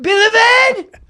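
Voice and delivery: drawn-out high shriek in mock Australian; loud, over-the-top Aussie mockery; outraged mocking echo. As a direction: screech the word slowly, scandalized. Shrieking back the Australian accent.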